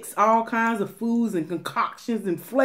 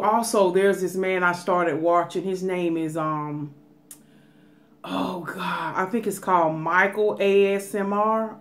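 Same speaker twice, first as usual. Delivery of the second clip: usual speaking voice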